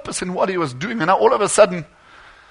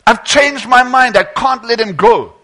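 raised, louder cry of a desperate father; retold shout; 4 semitones higher, 5 dB louder than usual. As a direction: burst out, pleading to take it back. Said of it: "and he shouted".

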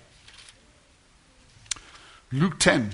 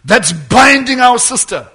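raised voice commanding a demon; loud rebuke; 4 semitones higher, 8 dB louder than usual. Retold or imitated. Preacher retelling the prophet's rebuke, said loudly. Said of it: "he says it loud".

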